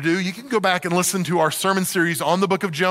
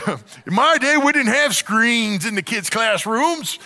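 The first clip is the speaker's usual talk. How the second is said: put-on slow, higher old-timer drawl; old man voice; 4 semitones higher, slower than usual. Shown as a demonstration. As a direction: play a grumpy old-timer reminiscing. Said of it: "I sound like an old man when I say that".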